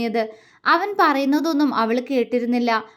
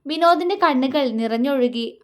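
usual speaking voice

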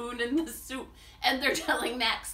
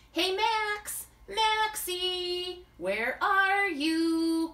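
higher, drawn-out call through the house; 9 semitones higher, 3 dB louder, much slower than usual. Acted. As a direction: call for a missing friend. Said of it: "called Brody".

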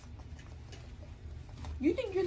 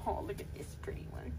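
soft whisper; 8 semitones lower, 9 dB quieter than usual. Real, not acted. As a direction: breathe it quietly. Whispering to puppy.

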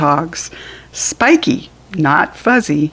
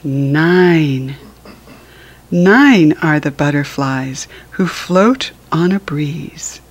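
ordinary delivery, nothing marked

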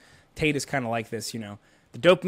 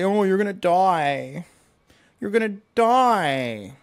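slow, louder, higher mock-alarmed warning; mocking doctors; 5 semitones higher, 6 dB louder, slower than usual. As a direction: ham up the doctors' doom warning. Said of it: "the doctors say".

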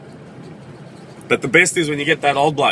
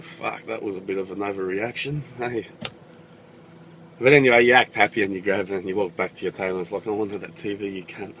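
low, soft aside of a disappointed winner; private grumble; 4 semitones lower, 5 dB quieter than usual. Acted. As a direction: grumble it under your breath.

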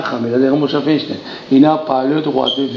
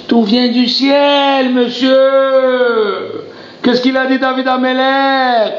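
impassioned preaching; 10 semitones higher than usual. Acted. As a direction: preach it out with full fervour. Preaching at full force.

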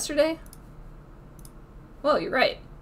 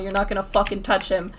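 ordinary talk, nothing marked

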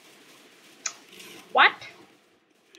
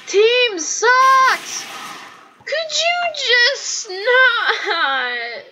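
slow, high exasperated wail; frustrated gamer whine; whining near tears; 5 semitones higher, much slower than usual. Real, not acted. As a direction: drag the words out as if about to cry. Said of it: "I'm about to cry".

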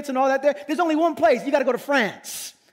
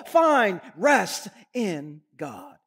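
gentle self-preaching; 6 semitones lower, much slower than usual. Acted. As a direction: drop down and slowly urge the soul. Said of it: "David starts preaching to himself".

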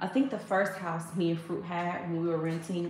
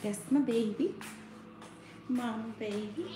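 tender coo; 5 semitones higher, 4 dB quieter than usual. Real, not acted. Cooing over sleeping baby.

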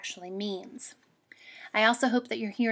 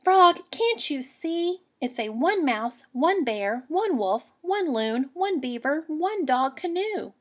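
higher and louder, slow at first; exasperated girl; 5 semitones higher, 4 dB louder, slower than usual. Acted. Girl to the frog, higher, louder, exasperated. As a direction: scold the frog in disbelief.

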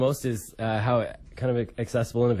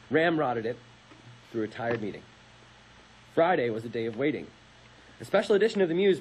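measured storyteller's read, phrase by phrase; dramatic reading; 3 semitones higher than usual. Acted. Host reads a campus protest story on air, dramatic narration. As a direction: read the history out like a story to the listeners.

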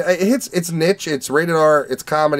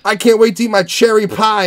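higher, louder mock eagerness; eager; 8 semitones higher, 5 dB louder than usual. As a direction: play the hopeful kid about to bite in. Eager kid before bad pie.

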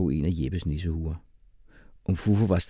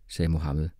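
plain reading voice

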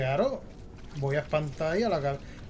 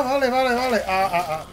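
loud, high frantic gasps of words; panicked vale vale; 8 semitones higher, 8 dB louder than usual. Real, not acted. Gamer still fleeing the monster, loud frantic words. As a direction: blurt it out in a panic.

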